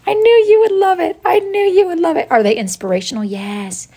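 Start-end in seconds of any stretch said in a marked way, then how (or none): 0.1-2.3 very high, delighted coo; squeaky, pleased-as-punch voice; high-pitched gushing. Real, not acted. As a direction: gush way up high. Gushing at viewer who loves it.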